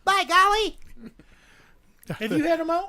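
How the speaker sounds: lightly voiced gee-whiz kid; a touch of old-timey wholesome kid; wholesome 1950s kid. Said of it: "from the fifties".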